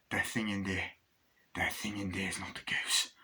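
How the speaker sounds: soft, hushed acted disbelief; hushed shock. Max having seen his burnt friend, hushed shock. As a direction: let it sink to a near whisper.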